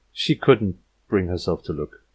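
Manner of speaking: usual speaking voice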